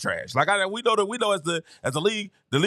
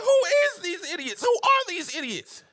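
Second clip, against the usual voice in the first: exasperated; 8 semitones higher than usual. Retold or imitated. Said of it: "We all see, like".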